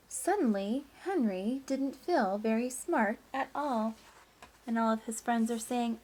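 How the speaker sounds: lively, sing-song storybook reading; reading to children. Acted. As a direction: read it like story time for a class. Teacher reads picture book aloud.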